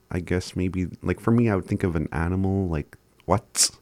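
usual speaking voice

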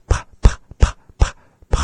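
loud voiceless "p" bursts; exaggerated breathy P. Shown as a demonstration. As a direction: blast breath out after each "p". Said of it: "really exaggerate those".

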